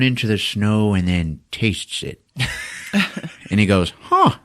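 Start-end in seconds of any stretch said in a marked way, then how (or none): 4.0-4.4 high, squeaky little discovery; mock amazed soldier. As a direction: imitate a soldier's high-pitched realization. Soldier tastes the snow, amazed.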